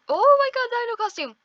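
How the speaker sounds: high, loud burst of amazement; delighted surprise. Real, not acted. Player spotting a dino costume, delighted surprise.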